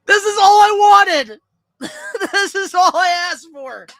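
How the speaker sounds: high, loud, cracking shouts; ecstatic screaming. Real, not acted. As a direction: scream with emotional joy. Fan overjoyed about pick.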